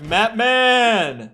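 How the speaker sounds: loud, high call across voice chat; yelling a gamer tag over voice chat. Retold or imitated. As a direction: shout to get the random player to talk. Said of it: "over voice".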